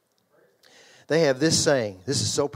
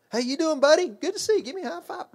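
put-on high, bright-pitched hello to a little kid; cheerful greeting to a kid; 13 semitones higher, faster than usual. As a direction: greet the child like a buddy, pitched way up. Greeting a child at church.

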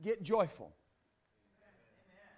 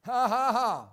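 loud, punchy "ha ha ha"; big spoken-out preacher's laugh; exultant laugh; 8 dB louder than usual. Real, not acted. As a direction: belt out a triumphant laugh. Exulting over the scripture read.

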